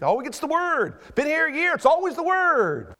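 high, exasperated grumbling; mock complaint. Church member griping to the pastor, mock exasperation. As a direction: imitate a fed-up member, pitch climbing.

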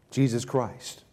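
ordinary delivery

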